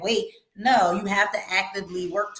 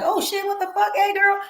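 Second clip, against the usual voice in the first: high, squealing mock shock; shocked what the fuck; 12 semitones higher than usual. Shown as a demonstration. Host showing a guest a shocked reaction. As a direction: gasp it out like a scandalized friend. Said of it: "what the fuck can be like".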